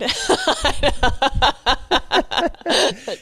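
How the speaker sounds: a high, loud burst of laughter. Real, not acted. Reacting to the Southern accent.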